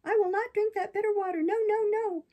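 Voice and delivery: high, loud puppet tantrum; tearful outburst. A narrator's reading. Puppet refusing the fairy's bitter water, tearful. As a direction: refuse loudly, as if near tears. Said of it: "bursting into tears".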